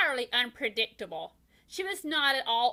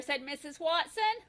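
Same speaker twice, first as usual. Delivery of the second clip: plain reading voice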